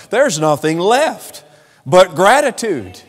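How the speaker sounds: high-pitched, insistent sermon voice; impassioned declaration to the congregation; fervent preaching. Acted. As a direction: proclaim it in a rising pulpit cadence. Preaches on grace.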